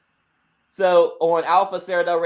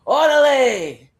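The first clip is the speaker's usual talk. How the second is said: loud, high, drawn-out cry; hyped catchphrase shout; 6 semitones higher, 8 dB louder, much slower than usual. Acted. Wrestler answers the erupting crowd, shouting long and loud. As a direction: stretch it out over the crowd.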